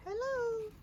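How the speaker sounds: high, soft greeting from a figure; high little voice. Acted. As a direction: pipe up from the treetop. Character greets from tree.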